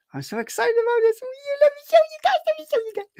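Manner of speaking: very high, squeaky gushing; over-excited squeal. Pretend. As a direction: squeal it fast like a giddy fan. Repeats himself in gushing squeal.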